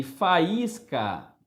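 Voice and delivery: "faísca" drawn out as fa-ÍS-ca; hiatus split slowly. Shown as a demonstration. Teacher showing a hiatus, "faísca" very slowly as fa-ís-ca. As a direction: separate the vowels of "faísca" into two slow syllables. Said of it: "dois sons vocálicos ficam em sílabas diferentes".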